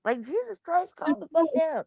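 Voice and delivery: high-pitched mock exasperation; exasperated retort. Retelling a scolding of the feisty cat, exasperated. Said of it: "And I was like".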